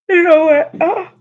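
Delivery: choking up mid-sentence; coughing fit. Real, not acted. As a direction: voice breaks into a cough. Sudden coughing fit, grabs water.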